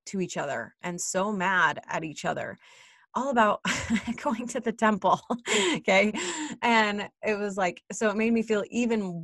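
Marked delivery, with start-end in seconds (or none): 3.1-6.1 higher, breaking into laughs; laughing in disbelief. Real, not acted. Mom admitting what the fight was about, laughing in disbelief. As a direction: laugh through the words at the absurdity.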